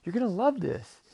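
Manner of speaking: high, loud, excited creature voice; eager cartoon octopus; urging octopus. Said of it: "the octopus says".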